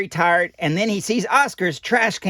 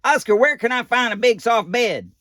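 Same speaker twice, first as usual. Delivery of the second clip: Ernie voice; 7 semitones higher than usual. Acted. Ernie voiced in read story.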